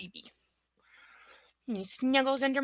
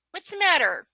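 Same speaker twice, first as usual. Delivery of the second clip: high, loud pet-talk coaxing; coaxing the dog; 9 semitones higher, 6 dB louder than usual. Real, not acted. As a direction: cheer the puppy on to jump up.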